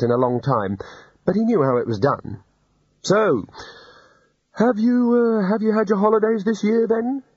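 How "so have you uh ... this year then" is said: high-pitched awkward small talk; hesitant, high barber patter; chatty barber act. A narrator's reading. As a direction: make strained barbershop chit-chat, pitched high. Rincewind playing barber to sheep.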